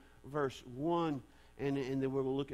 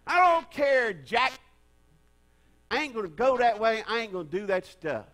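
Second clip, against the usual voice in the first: stubborn shout; 5 semitones higher, 11 dB louder than usual. Retold or imitated. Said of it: "I'm actually talking to Jack".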